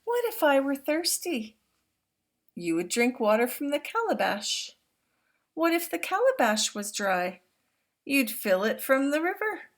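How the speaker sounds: put-on storybook reading to young listeners; reading to children. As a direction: read it out big and warm for the class. Reads picture book aloud.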